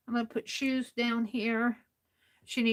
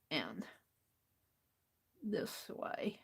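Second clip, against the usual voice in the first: whisper; 4 semitones lower, 13 dB quieter, much slower than usual. Real, not acted. Quiet crafting aside.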